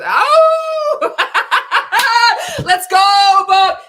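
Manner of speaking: high, very loud whoop through laughter; laughing cheer. Real, not acted. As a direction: cheer him on, cracking up.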